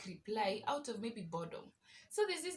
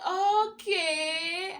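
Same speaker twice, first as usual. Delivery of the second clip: much louder and higher drawn-out okay; exaggerated okay; 9 semitones higher, 14 dB louder than usual. Telling the scammer she sent details, loud and drawn out. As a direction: belt out a long fake-cheerful okay.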